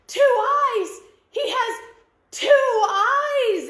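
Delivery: high, loud terrified shriek; hammed-up storybook shriek; shrieking in terror. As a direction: scream it as if you've seen a monster. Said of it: "started shrieking and falling back in terror".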